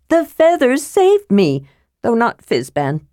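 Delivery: high-pitched, breathless kender chatter; excited shouting. A narrator's reading. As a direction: gush out the news eagerly. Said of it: "Tass shouted".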